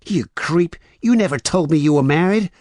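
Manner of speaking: high, loud outraged shout; shouted high, full of outrage; angry shout. A narrator's reading. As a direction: yell it as a furious accusation. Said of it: "Honey shouted".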